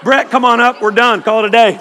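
loud call across the room; joking call-out. Pretend. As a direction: jokingly summon someone to wrap it up. Calls Brett up to finish.

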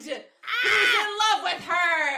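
high, loud, drawn-out with laughter; excited slow squeal of gossip; gleeful reveal. Real, not acted. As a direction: shout the juicy reveal, laughing, stretching it out. Gleeful big reveal while retelling.

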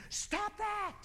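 very high-pitched squealed reprimand; mock scolding. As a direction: cut off the complainer in a squeaky high voice. Scolding the imagined complainer.

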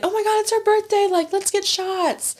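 high-pitched mock excitement, dragging the words out; excited friend. Acting out friend's birthday excitement.

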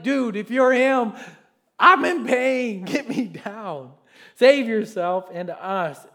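slow, loud pained plea; mock pained criminal begging loud; pleading criminal. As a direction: cry out in mock pain.

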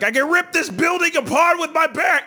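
high-pitched pumped-up bravado; hammed-up shout. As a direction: yell it like a gym beast showing off. Acts out pumped-up gym bravado.

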